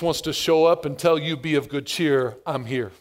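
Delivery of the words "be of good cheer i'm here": soft reassurance. Acted. Jesus voiced in a storm.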